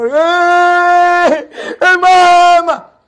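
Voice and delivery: high, loud, very slow mock crying for mama; mock wailing. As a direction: ham up a fake sob. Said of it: "dia pun menangis". (tangisan pura-pura yang dibuat-buat)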